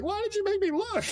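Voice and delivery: very high, hammed-up distressed whine; mock wail. Neville to Ron after looking at Harry, mock wail. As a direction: whine it shrilly in fake despair. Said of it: "And he's like".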